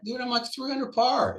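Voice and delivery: high-pitched retold disbelief; exasperated plea. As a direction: plead that your great round can't end now. Arguing with cart staff at siren.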